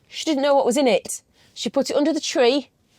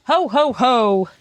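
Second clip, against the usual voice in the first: Santa laugh; 4 dB louder, much slower than usual. Pretend. Father Christmas laugh at Christmas.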